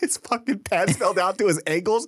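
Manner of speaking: high, giggly imitation of explaining through laughter; retold helpless laughing. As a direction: squeeze it out as if cracking up. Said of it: "just laughing".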